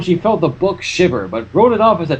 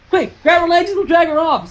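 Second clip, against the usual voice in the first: urgent Applejack; 11 semitones higher than usual. A narrator's reading. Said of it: "said Applejack".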